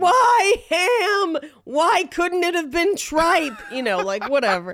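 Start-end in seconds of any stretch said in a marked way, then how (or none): 0.0-3.5 higher, louder whiny cry; mock wail. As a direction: wail it like an upset child. Continues the child's wail.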